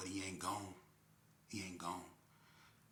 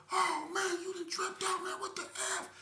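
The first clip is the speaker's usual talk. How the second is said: much higher, louder shocked voice; shocked outcry; 8 dB louder, slower than usual. Retold or imitated. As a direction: cry out in disbelief. Homeboy shocked at accidental shooting.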